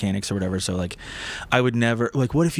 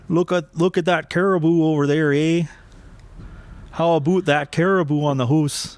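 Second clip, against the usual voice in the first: mock Canadian accent; 6 semitones higher, 3 dB louder than usual. Mocking a co-host's Canadian accent, higher and louder. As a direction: tease a co-host with a jokey Canadian impression. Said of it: "What would they say?".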